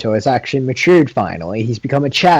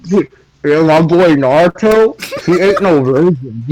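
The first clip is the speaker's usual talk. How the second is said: higher, louder, slow bro brag; hyped bro voice; 6 semitones higher, 4 dB louder, slower than usual. Pretend. Boasting to his co-host about Naruto, hyped bro voice. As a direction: brag about your boy like a hype man.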